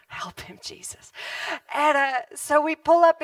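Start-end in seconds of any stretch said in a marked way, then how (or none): 0.1-1.1 breathy whispered aside; whispered prayer. Retold or imitated. Preacher to audience, whispering a prayer for the cannibal. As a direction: whisper it like a quick prayer under your breath.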